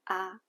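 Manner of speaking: short, low "ah"; third tone. Shown as a demonstration. Teacher demonstrating everyday third tone, short and low. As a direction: keep "ah" brief and low. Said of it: "it sounds like a short low tone".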